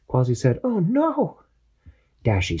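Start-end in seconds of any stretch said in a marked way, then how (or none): 0.6-1.4 high voice calling over radio; Quasi's voice. Acted. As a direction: call out anxiously for the captain. Quasi alarmed.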